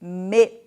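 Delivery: ME with a closed E; closed, narrow E on "Me". Shown as a demonstration. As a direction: say "Me" as a low, isolated MÊ. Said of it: "o E fechado".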